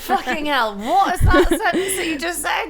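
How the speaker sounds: high, loud laughing disbelief; mock outrage. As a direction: exclaim in appalled amusement. Mock outrage at co-host's phrase.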